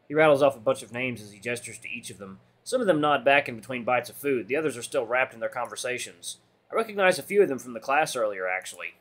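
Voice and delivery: ordinary delivery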